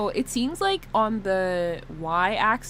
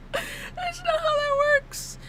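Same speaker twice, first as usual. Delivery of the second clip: high, slow, squeaky mock whine; mock crying; 3 dB quieter, slower than usual. Streamer bemoaning her own mistake, high mock crying. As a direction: whimper it in a tiny high voice, mock tearful.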